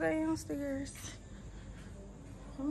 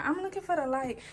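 normal talking voice

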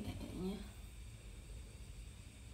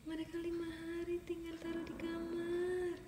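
ordinary talk, nothing marked

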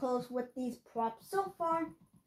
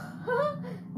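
giggle; 9 semitones higher than usual. Real, not acted. Laughing at glitchy prop.

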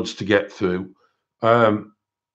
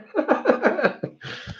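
high-pitched helpless giggling; real laughter; 4 dB quieter than usual. Real, not acted. Laughing at own French attempt, high giggles.

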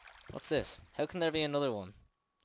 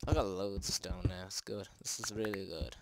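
usual speaking voice

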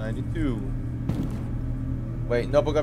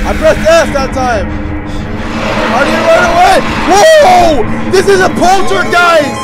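high, very loud frantic yelling; real panicked screaming; 13 dB louder, slower than usual. Real, not acted. Player attacked by a poltergeist, panicked screaming. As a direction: scream the protest in terror.

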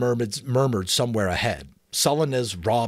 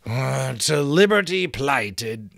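slow groan of a stuck reciter; 5 semitones higher, 5 dB louder, slower than usual. Acted. Giant struggling to recall the password, slow groan. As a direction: drag the words out, groaning for the next line. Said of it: "scratched his head again and groaned".

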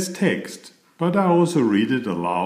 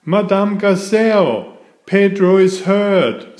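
calling for help; 7 semitones higher, 6 dB louder than usual. A narrator's reading. Peppa calls the teacher for help.

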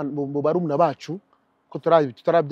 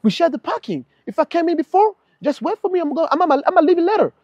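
indignant protest; 10 semitones higher than usual. Retold or imitated. Retold parking dispute reply.

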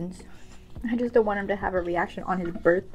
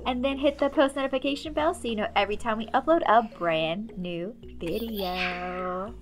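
upbeat vlog outro; 4 semitones higher than usual. Acted. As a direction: wrap up the video like a host.

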